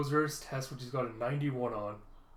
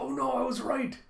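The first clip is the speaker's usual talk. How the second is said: put-on dismayed child; 10 semitones higher, 3 dB louder than usual. Acted child realizing the bad news.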